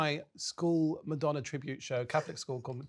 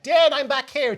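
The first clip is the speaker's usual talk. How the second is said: calling out; 10 semitones higher, 11 dB louder, slower than usual. Retold or imitated. Calling out to lost aunt.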